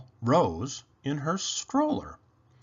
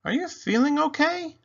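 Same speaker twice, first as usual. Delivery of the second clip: concerned mother. Acted. Mama worried about Penny.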